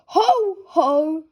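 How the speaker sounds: a big daddy laugh; jolly father laughing. Acted. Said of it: "laughs Daddy".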